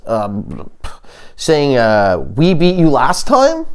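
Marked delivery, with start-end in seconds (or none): 2.3-3.7 lightly mocked tough guy, pitched up; higher-pitched mock boast. Comic taunting Canadians about an old win, mock tough guy.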